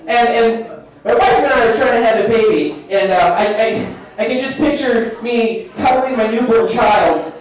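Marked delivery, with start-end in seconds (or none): none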